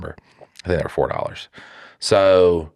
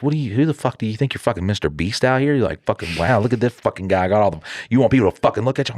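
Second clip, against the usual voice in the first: higher, fast scolding rant; angry wife rant; 3 semitones higher, faster than usual. Retold or imitated. Wife scolds her husband for paying for everyone, fast and higher. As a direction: tear into him for showing off.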